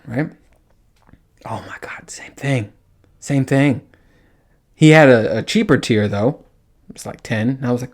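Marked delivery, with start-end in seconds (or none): 1.4-2.7 whispered aside; hushed disbelieving whisper. Real, not acted. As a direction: breathe it out unvoiced in disbelief.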